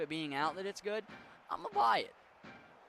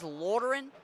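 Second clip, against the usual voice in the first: raised, louder Yinzer pronunciation; Yinzer accent; 6 semitones higher, 5 dB louder than usual. Retold or imitated. Streamer mimicking how mom says it, Yinzer accent. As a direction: imitate a mom's Pittsburgh Yinzer accent. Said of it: "She says".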